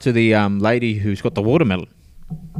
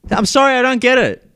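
much higher, louder, put-on voice; confused man repeats apology; acted confused stranger; 9 semitones higher, 4 dB louder than usual. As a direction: play the baffled guy who doesn't get it. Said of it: "And he said".

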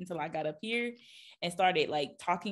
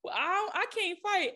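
friend's panicky voice; 9 semitones higher than usual. Retold or imitated. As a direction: imitate a scared friend who can't fight. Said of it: "She was like".

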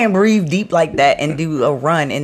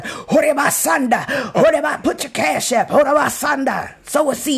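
mock prophesying; 7 semitones higher than usual. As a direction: ham up a tongues-speaking prophet. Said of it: "If we was on here prophesying".

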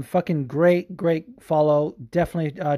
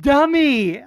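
higher, louder mock jab; mocking dummy; 12 semitones higher, 7 dB louder than usual. Retelling Chael's insult, loud mocking jab. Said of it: "he ends it with".

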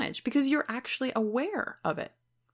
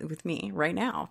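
ordinary talk

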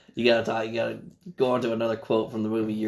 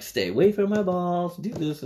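pitched-up panicky movie line; higher excited quote; 7 semitones higher than usual. Retold or imitated. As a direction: squeak it out in a panic. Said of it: "He's like".

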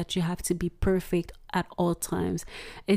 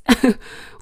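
short embarrassed laugh; 4 semitones higher, 7 dB louder than usual. Real, not acted. Laughing at own mispronunciation.

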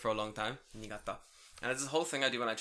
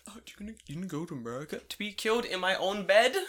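mock question; 6 semitones higher, 5 dB louder than usual. Mocking people's question.

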